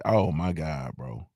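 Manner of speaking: a low, fading "oh my God, bro"; deflated dismay. Retold or imitated. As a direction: sink into quiet disbelief. Recalling the fishnet walkout.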